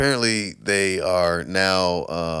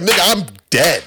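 loud, high exasperated cry; exasperated shout; 10 semitones higher, 8 dB louder than usual. Retold or imitated. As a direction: yell it in mock despair. Retold complaint, loud high.

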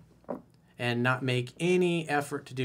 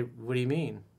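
soft probing question; 6 dB quieter than usual. Shown as a demonstration. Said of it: "I would say".

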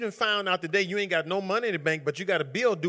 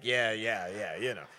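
much lower, offhand mock shrug; lazy, lowered imitation of a casual answer; nonchalant; 9 semitones lower, 5 dB quieter than usual. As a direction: toss it off like it's no big deal. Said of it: "like, nonchalant".

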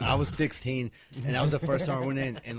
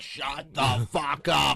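very slow, louder mock-furious mother; aggressive mom; 2 semitones higher, 5 dB louder, much slower than usual. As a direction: drag out every word as a threat. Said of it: "like, aggressively, like, with her head moving".

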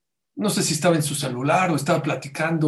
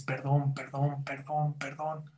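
fading plea; 8 dB quieter, slower than usual. Retold or imitated. Sister begging forgiveness.

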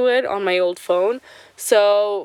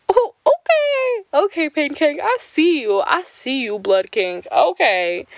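mock gasp; 7 semitones higher than usual. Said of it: "I'm like".

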